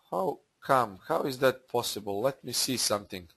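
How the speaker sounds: soft, low thinking aloud; puzzled muttering. Real, not acted. Trader puzzled by his own results, muttering to himself. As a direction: drop the volume and puzzle it out to yourself.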